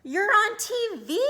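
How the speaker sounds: high, loud little-sister squeal; excited scream. A narrator's reading. Sister to Arthur when he got home, screaming. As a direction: shriek the news at your brother. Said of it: "screamed D W when Arthur got home".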